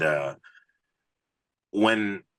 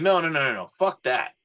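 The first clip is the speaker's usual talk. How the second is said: insistent producer; 8 semitones higher than usual. Retold or imitated. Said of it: "And then he was like".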